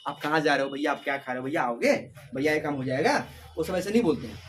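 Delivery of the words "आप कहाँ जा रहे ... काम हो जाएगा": lower, gentle polite asking; polite speech. Retold or imitated. Teacher contrasting polite everyday Hindi with Haryanvi, gentle and lower. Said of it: "हम लोग जब normally बोलते हैं".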